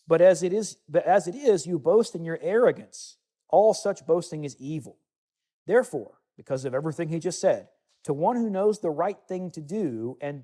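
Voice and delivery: solemn bible reading from the pulpit; scripture reading. Acted. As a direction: proclaim the verses to the congregation. Continues reading James passage.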